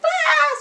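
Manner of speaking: short, high, loud mock scream; a hammed-up burst of outward panic. Showing viewers the panicking mother she is not, high mock scream.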